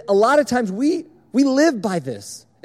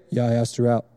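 lower, deep bored monotone; monotone Kason; 8 semitones lower than usual. Retold or imitated. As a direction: say the good news like it means nothing. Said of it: "in a monotone".

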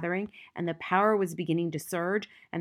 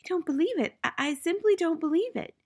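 high and louder, stammering in shock; gasping disbelief; 10 semitones higher, 4 dB louder than usual. A narrator's reading. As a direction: blurt it out, unable to believe. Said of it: "Miss Honey was gasping".

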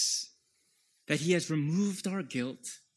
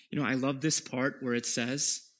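ordinary talk, nothing marked

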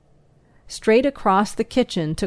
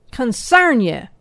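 put-on a touch higher, cursing old man; angry outburst; 4 semitones higher, 4 dB louder than usual. A narrator's reading. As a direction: bark it out at him. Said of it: "ejaculated old Jake".

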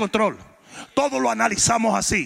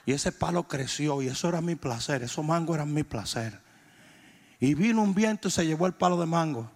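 low, soft imitation of a hurt man; aggrieved confiding; 6 semitones lower, 6 dB quieter than usual. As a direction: confide a small loss very earnestly, hushed. Voices aggrieved man confiding his loss.

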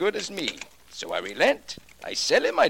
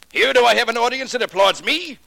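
louder and higher, bitter and accusing; bitter outburst; 7 semitones higher, 4 dB louder than usual. Acted. Pop accuses Mento of ruining his career, bitter outcry. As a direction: hurl the questions back at him in bitter grief.

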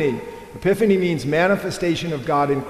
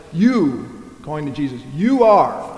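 "You" then "you are" with "you" punched louder; stress on you; 4 dB louder than usual. Shown as a demonstration. Preacher stressing how personal God's word to Jesus is, punching "you". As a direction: lean hard on each "you". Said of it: "this is very particular".

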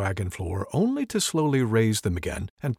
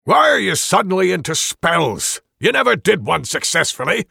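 gruff jab; 7 semitones higher, 7 dB louder than usual. Acted. Dwarf mocks wizard.

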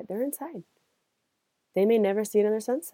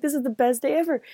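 higher, fast, gushing; giddy patient; 7 semitones higher, faster than usual. Retold or imitated. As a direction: rush it out in dazed joy.

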